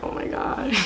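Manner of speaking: voiceless panicked whisper; hushed dread. Retold or imitated. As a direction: whisper it in mock dread, barely audible. Retold panic as the mother approaches.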